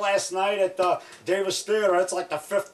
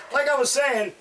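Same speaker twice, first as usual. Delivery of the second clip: normal talking voice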